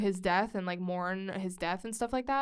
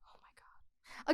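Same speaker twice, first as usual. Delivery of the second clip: whisper; 11 dB quieter than usual. Real, not acted. Agonizing over a choice.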